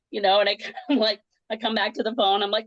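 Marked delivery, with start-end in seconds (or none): none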